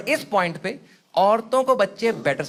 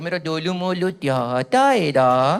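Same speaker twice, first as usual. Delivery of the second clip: put-on slow cooing lisped baby talk; sweet lisping voice for an infant; 6 semitones lower, slower than usual. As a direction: coo at a baby, drawn out. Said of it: "ऐसे बात करते कि".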